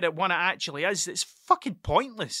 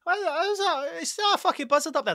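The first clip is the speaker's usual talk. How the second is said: much higher, quick squeaky little mouse; silly mouse voice; 10 semitones higher than usual. Acted. Mouse spotting a buzzard, high quick squeaky voice. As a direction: play a dopey, curious mouse looking up. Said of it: "the mouse that turns round and goes".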